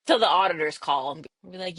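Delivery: ordinary talk, nothing marked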